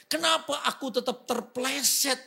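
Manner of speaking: loud, high, reproachful whining at God; angry complaint. Retold or imitated. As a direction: scold God for letting him fall. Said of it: "dia mulai marah-marah".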